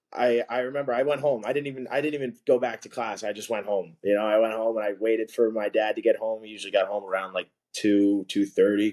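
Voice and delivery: normal talking voice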